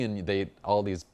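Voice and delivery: normal talking voice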